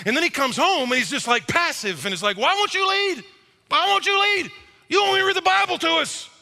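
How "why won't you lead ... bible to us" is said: high, pleading wife's complaint; frustrated wife. Retold or imitated. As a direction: voice the wife nagging him to lead, pitched up. Wife voiced complaining to husband.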